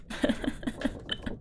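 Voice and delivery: soft, breathless giggle; quiet fit of giggles; breathy laugh. Real, not acted. Streamer amused by their own guess, breathy giggle.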